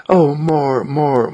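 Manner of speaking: high, eager begging; pleading mankind. Acted. All mankind answering the whisperer, high and eager. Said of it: "all mankind is listening and saying".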